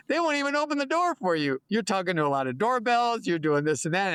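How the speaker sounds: high, mocking imitation of a doubting broker; sing-song mockery of the broker's warning. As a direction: parrot the naysayer in a high, silly voice. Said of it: "so I go".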